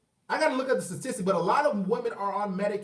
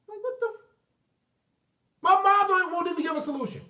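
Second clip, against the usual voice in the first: exasperated; 10 semitones higher than usual. Real, not acted. Exasperated at mom's answer.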